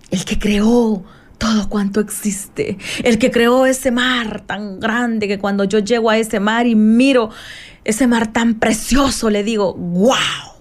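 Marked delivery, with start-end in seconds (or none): 0.1-2.8 slow reverent whisper; hushed wonder at creation; awed whisper. Acted. 9.7-10.5 reverent little wow; awed wow. Retold or imitated. Reacting to the sea.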